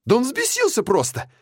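high frantic yell; panicked shout. Acted. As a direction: cry it out high and upset. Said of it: "крикнул биолог".

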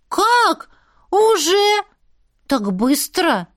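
put-on high, whiny kid voice; high childish protest. Pixie boys protest lesson ending.

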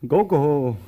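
firm wake-up call. Acted. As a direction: raise the call to rouse him. Calling louder to wake him.

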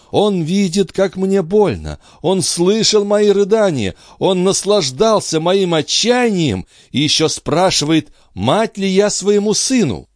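high-pitched, impassioned woman in despair; anguished mother. Acted. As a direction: pour out hurt indignation in a high voice. Андре в отчаянии. (мать в отчаянии, высоким страстным голосом)

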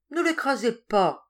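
pleading. A narrator's reading. Madwoman begging the warder to spare her cockchafer, pleading. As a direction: beg anxiously, drawing the words out. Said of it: "supplia-t-elle".

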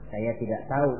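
low, soft answer; innocent reply. Acted. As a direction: answer quietly, unknowing. Hajar answers the devil. (menjawab pelan, polos)

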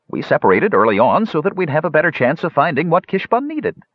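high-pitched, earnest explanation; high character voice. Acted. Little Shoulders explains to the crowd, high character voice.

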